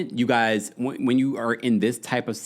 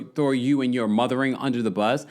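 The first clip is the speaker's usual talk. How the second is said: ordinary delivery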